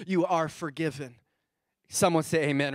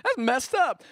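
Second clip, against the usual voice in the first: mock outrage; 7 semitones higher, 3 dB louder than usual. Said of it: "I'm like".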